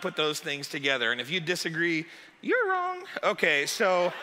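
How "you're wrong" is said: very high-pitched playful put-down; teasing verdict. Acted. Pastor to pineapple fans who disagree, teasing high voice. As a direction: lift it way up, mock-sweet.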